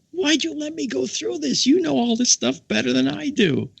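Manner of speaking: very high mock wail; retold flabbergasted protest. Speaker to the expert he just lectured, high flabbergasted protest. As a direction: protest in high, embarrassed disbelief.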